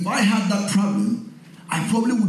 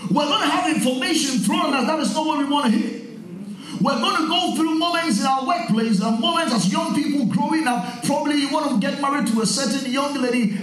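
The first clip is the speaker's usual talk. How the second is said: higher, building sermon delivery; rising preaching; 8 semitones higher than usual. Acted. Pastor urges the congregation, building preaching fervor.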